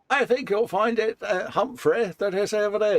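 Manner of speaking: high, lightly voiced posh officer; posh officer impression. Co-hosts joking about the figure, lightly voiced posh officer.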